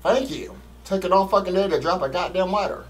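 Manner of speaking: ordinary talk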